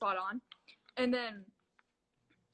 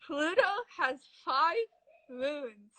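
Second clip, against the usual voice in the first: talking through a cheek retractor; mouth guard in; 4 semitones higher, 3 dB louder, slower than usual. Real, not acted. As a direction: lips stretched wide, words mangled. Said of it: "you're gonna put this funny thing in your mouth".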